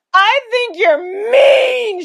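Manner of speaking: high, loud, drawn-out wail; bawling. A narrator's reading. Mother wails at father over the car, bawling yell. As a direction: bawl it like a child. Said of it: "began to bawl".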